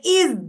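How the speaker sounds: "is" as a high, voiced "iz"; iz ending. Shown as a demonstration. Teacher showing plural endings, buzzed iz. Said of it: "sometimes we say".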